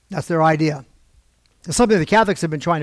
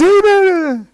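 very high, loud, stretched-out shout; mock crowd cheer; 14 semitones higher, 7 dB louder than usual. Whole meeting answers the speaker's call, hammed-up shout. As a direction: imitate a whole crowd hollering back.

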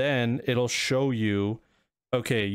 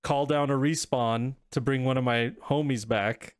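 ordinary talk, nothing marked

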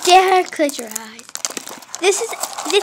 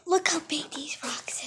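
whispering; 13 dB quieter than usual. Pretend. Awed whisper over the rocks.

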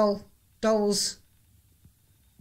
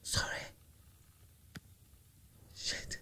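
whispering; 24 dB quieter than usual. Real, not acted. After screaming in fright.

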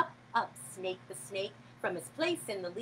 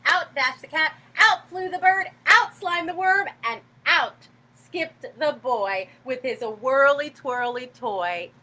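excited; 8 dB louder than usual. A narrator's reading. Energetic escape list.

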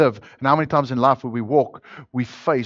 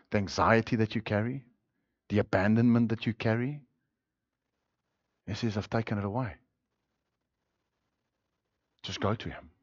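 softer, gentle, comforting close; tender reassurance; 3 semitones lower, 8 dB quieter, faster than usual. Real, not acted. Preacher gently comforting the burdened congregation, softer. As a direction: lay it down softly like a pastor consoling.

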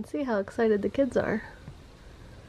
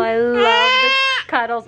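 very high, slow, loud cooing; 11 dB louder, slower than usual. Real, not acted. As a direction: gush over the cuddles. Cuddling baby, cooing.

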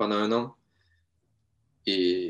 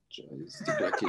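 laughing; 6 dB quieter, slower than usual. Real, not acted. Laughs about the bad photos.

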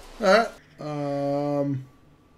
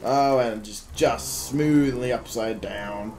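ordinary talk

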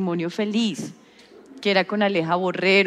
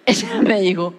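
normal talking voice

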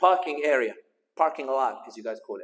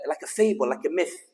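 normal talking voice

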